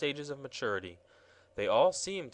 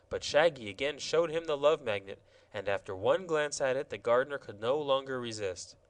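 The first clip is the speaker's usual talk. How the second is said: ordinary delivery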